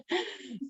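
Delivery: short high giggle after her own joke; light laugh. Real, not acted. Speaker laughing at her own joke to the audience, short high giggle. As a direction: let a quick bright giggle slip out. Jokes she can smile a lot.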